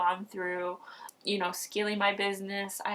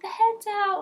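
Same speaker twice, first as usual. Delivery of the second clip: excited announcement; 6 dB louder than usual. Retold or imitated. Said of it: "They're like".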